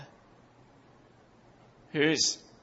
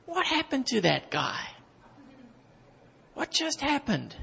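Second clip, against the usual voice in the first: higher, softer baffled voice; mock the friends' bewilderment, pitched up; puzzled friends; 7 semitones higher, 5 dB quieter than usual. Preacher imitating baffled friends after his conversion, higher and softer. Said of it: "My friends are still scratching their head".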